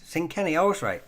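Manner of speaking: sudden high-pitched little triumph; pleased discovery. Real, not acted. Reader finds the pronunciation was right after all, pleased discovery.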